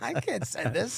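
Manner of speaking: high-pitched laugh, then disbelief; giggle, then still incredulous. Retold or imitated. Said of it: "I'm like".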